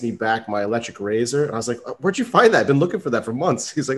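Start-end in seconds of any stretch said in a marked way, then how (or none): 1.9-3.7 high-pitched re-enacted amazement; retold surprise. To the robber handing back his razor, retold surprise. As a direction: act out startled delight, starting high and easing down.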